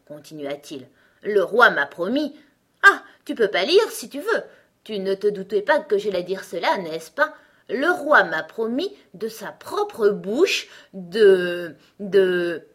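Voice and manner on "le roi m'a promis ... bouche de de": loud, grand boasting, drawn out at the end; boastful egg. A narrator's reading. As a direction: build importance slowly towards the big reveal. Egg character brags of the king. (gros coco solennel et vantard)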